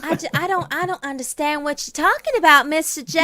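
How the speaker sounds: put-on high, loud plantation-South accent; Harley Quinn. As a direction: plead like a hammed-up Southern belle. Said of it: "hers is plantation South".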